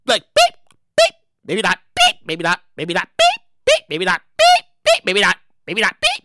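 mock beeps between muttered maybe nots; scanner beeps. Shown as a demonstration. Shopper faking scans, playful beeps and asides. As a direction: act out a sneaky self-checkout. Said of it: "demonstrates scanning routine".

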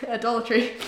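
laughing as she repeats it. Real, not acted.

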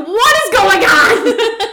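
slow, high, laughing disbelief; baffled exclaim. Retold or imitated. Hearing they're from Florida, slow baffled laugh. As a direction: stretch it out in disbelief. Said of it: "And I was like".

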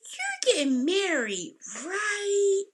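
high, drawn-out imitation of the girl; imitated girl's question. Girl at doctor's office asks the bride, high imitated question.

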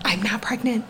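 whispered denial. Shown as a demonstration. Mom whispering her denial to the universe. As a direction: breathe it out as a whisper. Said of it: "I'm saying it right here".

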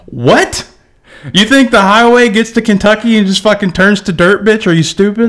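high, loud incredulous retort; mock outrage. Answering the lady about roads, loud and incredulous. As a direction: fire it back in disbelief.

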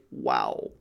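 very low, hushed, awestruck "Wow"; stunned wow. Retold or imitated. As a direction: drop the voice deep, as if the drink knocked you flat. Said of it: "They just say".

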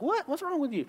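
hammed-up incredulous question; incredulous wife. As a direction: imitate a wife aghast at the fib. Wife reacts to husband's lie, acted.

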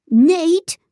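high, loud disbelieving cry; stunned high cry of a name; incredulous cry. Acted. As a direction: blurt the name in shock. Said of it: "Ethan cried".

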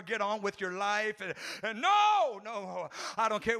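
ordinary talk

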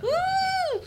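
high-pitched "woo" of excitement; excited whoop. Real, not acted. Co-host celebrates going to Scotland, excited whoop. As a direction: let out a high, happy whoop.

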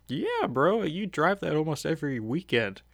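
high-pitched, teasing comeback; mock incredulous reply. Fan answering the driver who keeps praising the car, mock incredulous. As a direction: act out an amused retort, starting high and sliding down.

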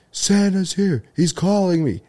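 high, breathless burst of news; imitating the friend's giddy squeal; excited announcement. Friend tells the group Santa is calling, mock excited.

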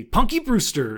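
high, loud battle cry; mock wrestling shout; calling out a move. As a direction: yell the move name like a wrestler.

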